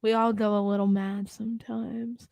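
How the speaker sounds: breathy whispered movie quote; creepy whisper. Retold or imitated. Friends quoting a horror movie, eerie whisper. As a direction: hush it like a killer's line.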